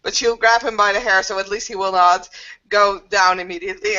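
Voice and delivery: ordinary talk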